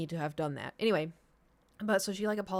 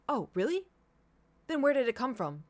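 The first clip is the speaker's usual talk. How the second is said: higher, pointed challenge, imitating a landlord; professional but aggressive; 6 semitones higher, 4 dB louder than usual. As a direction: challenge them with cool disbelief. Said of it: "he sounded so professional but aggressive".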